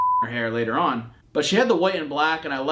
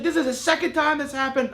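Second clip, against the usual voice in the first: frantic disbelief; 11 semitones higher than usual. Retold or imitated. Retold panic over lost dog.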